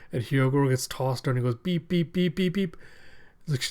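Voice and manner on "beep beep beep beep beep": high little electronic beeps; imitated beeping. Imitating the prison collar beeping as it counts down, high beeps. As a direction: chirp it like a warning alarm.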